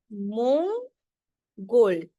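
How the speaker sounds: Mongol stretched very slowly, each part held; teacher slowing Mongol right down; very slow demo. Shown as a demonstration. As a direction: draw out Mongol so every sound can be heard. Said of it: "नीचे वाले अंग को pronounce करते हैं".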